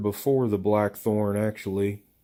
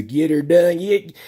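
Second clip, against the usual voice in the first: Larry the Cable Guy catchphrase; 7 semitones higher, 5 dB louder than usual. Retold or imitated. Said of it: "watching Larry the Cable Guy do the".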